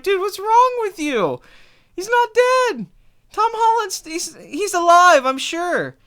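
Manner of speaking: very high, drawn-out exasperation; mock incredulous. Retelling his reaction to the dramatic moviegoer, incredulous. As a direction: squeal it in hammed-up disbelief. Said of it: "I just start laughing".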